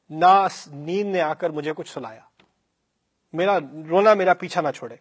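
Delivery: ordinary talk, nothing marked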